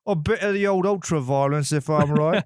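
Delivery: hammed-up British impersonation; British accent. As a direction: play it as a cheeky mock Brit. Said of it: "British accents and Australian accents".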